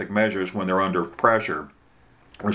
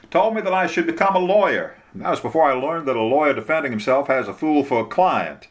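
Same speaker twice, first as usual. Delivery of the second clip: higher-pitched lawyer telling on himself; small-town lawyer's wry confession, pitched up; Bubba, confessing; 6 semitones higher than usual. Acted.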